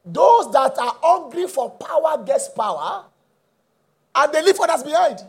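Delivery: high, ringing declaration; impassioned preacher's pronouncement; fervent preaching. Real, not acted. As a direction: proclaim it to the congregation with drawn-out emphasis. Preaching at full force.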